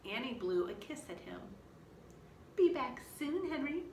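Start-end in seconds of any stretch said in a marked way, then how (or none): 2.6-3.8 light, cheerful goodbye; a cheerful little girl's farewell to a dinosaur; cheerfully. A narrator's reading. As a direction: wave him off brightly, a little higher. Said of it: "she said cheerfully".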